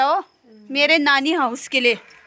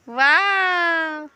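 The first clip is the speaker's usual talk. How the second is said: very drawn-out, higher "वाह"; admiring wow; 4 semitones higher, much slower than usual. Real, not acted. Mother showing off child's new look, long admiring wow. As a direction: stretch one long admiring exclamation.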